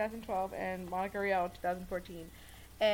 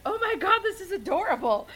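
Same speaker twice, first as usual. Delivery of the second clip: high, loud gushing delight; excited gushing; geeking out; 10 semitones higher, 6 dB louder than usual. Retold or imitated. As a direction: squeal it like a fan seeing something adorable. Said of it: "we both geeked out over it".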